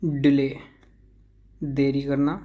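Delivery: usual speaking voice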